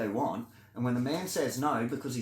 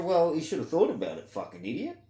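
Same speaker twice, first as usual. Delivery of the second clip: mock callous crowd, higher pitched; raised-pitch scornful brush-off, acted out; dismissive onlookers; 7 semitones higher, 4 dB louder than usual. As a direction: imitate people shrugging off someone's misfortune. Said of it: "they're gonna go".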